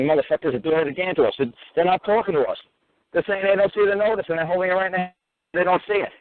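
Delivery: high-pitched imitation of an outcry; mock screaming and cursing. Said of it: "were all screaming and cursing".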